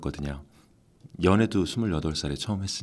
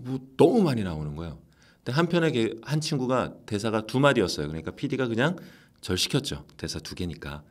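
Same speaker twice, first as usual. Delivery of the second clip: usual speaking voice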